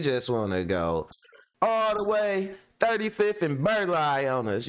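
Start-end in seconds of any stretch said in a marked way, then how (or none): 1.6-4.7 raised teasing voice. Real, not acted. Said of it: "mocking mom's street turn".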